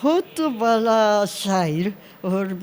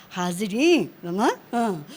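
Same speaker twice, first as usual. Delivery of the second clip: ordinary talk, nothing marked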